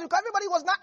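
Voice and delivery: ordinary talk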